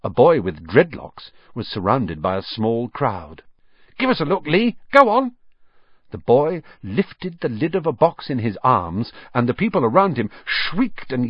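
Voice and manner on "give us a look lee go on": put-on eager boy voice.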